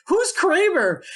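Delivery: very high, put-on puzzled voice; clueless party guest. One person interrupts the party chatter, high-pitched mock bewilderment. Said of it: "you hear this one person who's like".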